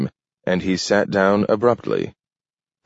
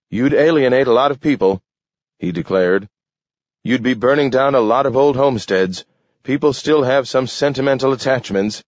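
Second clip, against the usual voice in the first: higher, quick objecting politician; protesting alderman; 7 semitones higher, 3 dB louder, faster than usual. A narrator's reading. Alderman objecting to burning homesteads, protesting.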